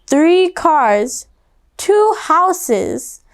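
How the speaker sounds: plain reading voice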